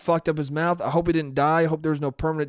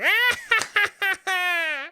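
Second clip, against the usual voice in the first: villain cackle. Acted. Old woman's cackle.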